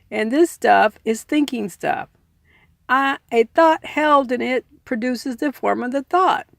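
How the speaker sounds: even, steady reading-aloud voice; calm nonfiction read; measured book reading. Acted. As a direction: read the passage out like an audiobook. Reads self-help book aloud.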